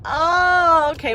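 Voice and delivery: loud, high laughing "oh, okay"; surprised at being recognized, laughing loudly; flustered laugh. Retold or imitated. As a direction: laugh it off, caught off guard. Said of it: "And we went".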